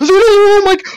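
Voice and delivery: high, loud startled shriek cut off; startled scream. Real, not acted. Gamer startled by an attack, screaming. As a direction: scream at the sudden jump scare. Sudden scare in game.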